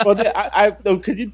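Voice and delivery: words broken up by real laughter; laughing too hard to finish the question. Real, not acted. Podcast host cracking up at his co-host's joke, laughing.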